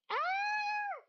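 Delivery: delighted shriek; excited scream. Real, not acted. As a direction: shriek with joy. Scream at new follow.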